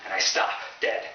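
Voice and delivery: ordinary delivery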